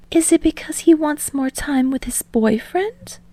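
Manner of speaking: high, curious teasing woman; Yaoyorozu voice. A narrator's reading. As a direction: innocent curious question.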